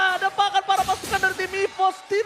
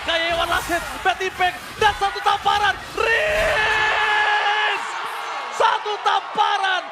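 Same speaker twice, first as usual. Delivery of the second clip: loud, high esports call; hyped play-by-play; 6 semitones higher, 5 dB louder, slower than usual. Real, not acted. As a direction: ride the fight at full volume. Caster calls a big teamfight. (gaya komentator esports yang berteriak)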